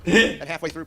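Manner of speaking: normal talking voice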